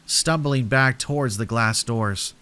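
ordinary delivery, nothing marked

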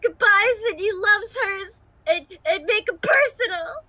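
loud, high sobbing orders; tearful demand. Acted. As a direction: boss them around through tears, in character. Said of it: "Kuriyami cries".

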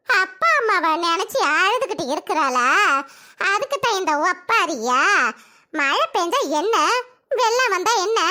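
low, sneering scoff at the tears; cold mother-in-law brushing off the weeping girl; scornful mother-in-law. Acted.

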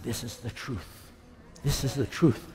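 soft, low inner voice repeating the truth; quiet inner voice, low and slow. Retold or imitated. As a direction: hush it like a voice inside you. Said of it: "a voice inside said".

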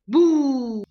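booming, very loud boo; terrible giant boo from behind the tree; huge boo. Acted. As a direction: jump out and scare with a giant boo. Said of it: "un terrible y gigantesco".

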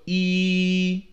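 "一" held high and level; high first tone. Shown as a demonstration. Teacher leading repeat-after-me, high level tone. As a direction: sustain "一" on one high, even pitch. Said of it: "first tone would be really high".

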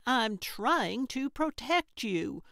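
exasperated cry. A narrator's reading. Old lady justifies herself to the dancer, exasperated cry. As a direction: cry it out, stretching the words. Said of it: "Gertrude cried".

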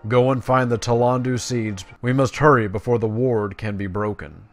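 lightly voiced, urgent quest-giver; earnest elf commander. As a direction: read it as an elf leader rallying a champion. Reads quest dialogue aloud in character.

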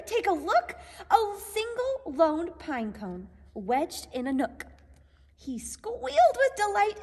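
lively sing-song storybook narration; reading to children. A narrator's reading. Parent reading a bedtime picture book aloud, playful. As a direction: read the rhyme to kids with bounce.

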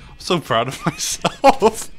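loud, high, breaking into a laugh; laughing pride. Real, not acted. Gamer proud he did it, laughing excitedly. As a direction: gush proudly through laughter.

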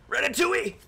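squeaky high call of Ratatouille!; teasing the rat with a squeaky cry; high sing-song call. Pretend.

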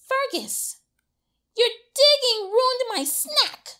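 high whiny complaint; upset piglet. A narrator's reading. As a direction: complain like a cross little pig. Dink upset.

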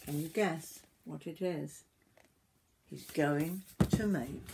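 ordinary delivery

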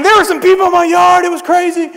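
high-pitched, hammed-up panic; mock frantic report. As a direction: blurt it out in an exaggerated high voice. Acting out frantic tweeting.

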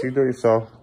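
normal talking voice

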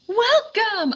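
booming high greeting, loud; bellowed. A narrator's reading. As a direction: bellow it out like a king. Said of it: "a voice bellowed".

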